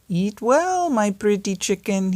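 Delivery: wolf cries out to the chicken; wolf crying out. Acted. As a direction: sing it out to the chicken. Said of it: "he cried".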